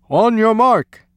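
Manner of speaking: loud, high starting call; coach's bellow. A narrator's reading. Coach starting the swimmers, loud bellow. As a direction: bellow it across the pool. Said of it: "bellowed the coach".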